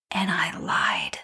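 slow, whispered admission; hushed confession. Real, not acted. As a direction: whisper it like a guilty secret. Confesses to lying.

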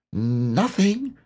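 small, very high animal voice; Piglet. A narrator's reading. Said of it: "said Piglet".

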